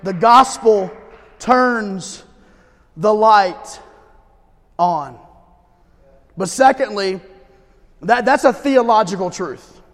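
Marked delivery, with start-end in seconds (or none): 0.0-5.2 each word set apart by pauses; slow spaced emphasis. Acted. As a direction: let every word land alone. Slow spaced preaching line.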